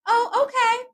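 high, sweetly forced okay; mock calm reply. Answering her sister with a high, mock-serene okay. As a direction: pretend to take it gracefully. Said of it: "I was just like".

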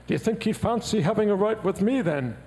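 lightly voiced, polite Liverpool ask. [name] quoted in a story.

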